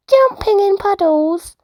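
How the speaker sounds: high, bright little bear voice; eager cub telling mama his fun; Fred's voice. Acted. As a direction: play the excited young cub. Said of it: "said Fred".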